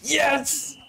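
high, joyful yes; triumphant cheer. Real, not acted. Streamer cheering as the team wins. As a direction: celebrate the win. Winning in game.

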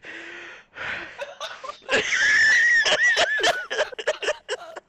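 shrieking high laugh, out of breath; cracking up so hard he can't breathe; wild laugh. Real, not acted. Laughing at his mates in the game, helpless shrieking laugh.

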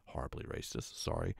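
hushed, voiceless apology; whispered aside. Real, not acted. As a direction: drop out of the story and whisper it. Reader's aside about the text.

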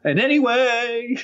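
slow, high, overloud blurt; talking too loud. Acted. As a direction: mimic a nervous speaker with volume out of control. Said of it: "you're talking loud".

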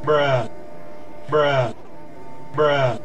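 ordinary delivery

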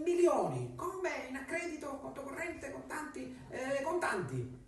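high, eager and breathless; greedy excitement. Acted. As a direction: grab for riches excitedly. Lucia asks for money.

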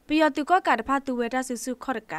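ordinary talk, nothing marked

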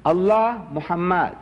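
slow, drawn-out reading aloud. Retold or imitated. Speaker re-enacts first reading the calligraphy, slow drawn-out reading. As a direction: sound out each name slowly. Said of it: "I'm reading".

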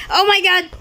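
high, sharp shocked cries; real startled alarm. Real, not acted. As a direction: blurt it out on first sight of the monster. Spots giant duck in game.

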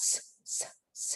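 "ส" as a short, isolated sibilant burst; phonics Z sound. Shown as a demonstration. Teacher demonstrating Z for children, short sibilant "ส" sounds. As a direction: sound out "ส" briefly, without the letter name. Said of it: "มีเสียงว่า".

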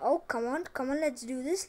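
ordinary talk

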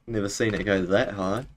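ordinary delivery

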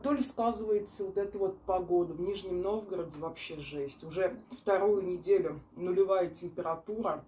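speaking between chews; talking while chewing. Real, not acted. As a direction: mouth partly full of food. Seen 0: Bite taken near -3; jaw and lip chewing motions visible while talking.

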